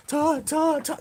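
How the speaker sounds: very high mock cry for help; imitated crying out. Expedition leader imitates people waking up crying for him, high-pitched wail. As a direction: imitate a sleeper calling out in distress. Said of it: "they wake up crying for Todd".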